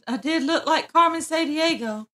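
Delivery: usual speaking voice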